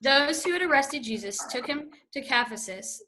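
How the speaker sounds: ordinary talk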